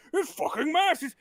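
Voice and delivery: put-on high, slow elderly grumbling; irritated old-timer voice; cranky old man. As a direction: drag it out like a disgusted old man. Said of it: "why are you so mad".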